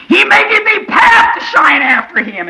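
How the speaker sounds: high, loud, slow chanted cry; full-force scripture preaching. Acted. Preacher crying out the Leviathan verses to the church. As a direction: ring each verse out over the church.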